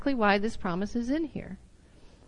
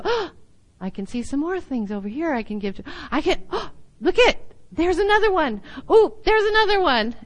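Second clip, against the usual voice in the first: acted excited discovery; 6 semitones higher than usual. Cheerful giver spotting more things to give to, excited. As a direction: get more thrilled with each new find, pitch climbing. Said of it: "So you go".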